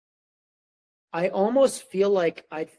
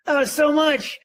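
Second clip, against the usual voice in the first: higher and louder, a playful "oh no, so much"; acting swamped by the huge task; mock overwhelmed gasp; 10 semitones higher, 7 dB louder, slower than usual. Overwhelmed by a big writing task.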